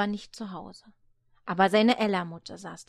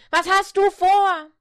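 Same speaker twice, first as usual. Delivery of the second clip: high, loud angry shout; 9 semitones higher, 11 dB louder than usual. Acted. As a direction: devil yelling at grandmother. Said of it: "schrie der Teufel".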